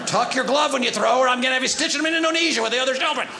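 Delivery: loud, fast barking orders; fast loud coach instructions; yelling coach. Acted. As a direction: drill the kids loudly.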